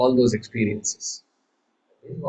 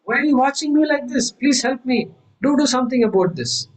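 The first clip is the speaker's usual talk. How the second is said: high, desperate plea; high-pitched hammed-up pleading; mock pleading; 11 semitones higher than usual. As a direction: beg the onlooker for help. Dreamer pleading with onlooker.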